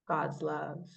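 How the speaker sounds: ordinary delivery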